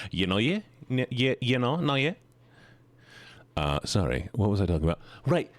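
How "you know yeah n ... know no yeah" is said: Kiwi accent, every phrase rising; New Zealand accent. Shown as a demonstration. Streamer practising Kiwi filler words, accent rising each time. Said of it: "stereotypical New Zealander accent".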